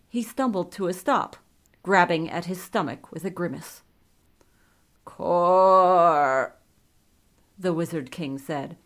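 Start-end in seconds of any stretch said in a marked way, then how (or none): 5.0-6.6 loud, long-drawn brutish groan of a word; dim brute. A narrator's reading. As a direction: force it out like a dull brute. Korgar speaks through Wizard King.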